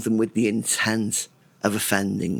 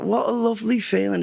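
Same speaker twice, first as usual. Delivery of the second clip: high delighted thought; 10 semitones higher, faster than usual. Retold or imitated. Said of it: "And I thought".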